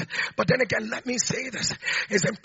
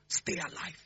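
breathy whisper, almost no voice; barely whispered last words; whispered advice; 6 semitones lower, 12 dB quieter than usual. Retold or imitated. Dying mother urges her son: whispered plea. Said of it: "And she said to me".

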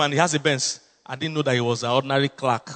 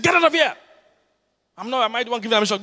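high imitated shout; retold shout; 7 semitones higher, faster than usual. Pastor mimicking the clerk shouting him away, high-pitched. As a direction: toss him away with a sharp yell. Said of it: "The guy shouted at me".

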